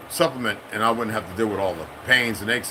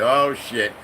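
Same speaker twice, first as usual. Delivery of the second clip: normal talking voice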